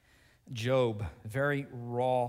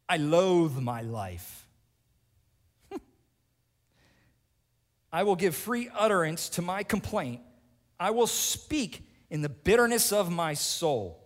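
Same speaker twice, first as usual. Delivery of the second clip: loud, higher bitter complaint; bitter Job; 3 semitones higher, 6 dB louder than usual. Acted. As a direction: hurl the complaint at God. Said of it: "listen to Job's prayers".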